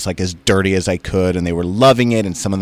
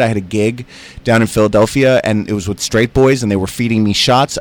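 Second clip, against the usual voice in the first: ordinary delivery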